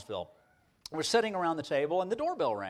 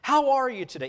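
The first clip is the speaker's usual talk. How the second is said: put-on high, loud, jovial older preacher; hearty greeting; 9 semitones higher, 9 dB louder than usual. Older professor greeting any student on campus, hearty and high. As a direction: greet a stranger like a dear old friend. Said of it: "first of all, would talk to them".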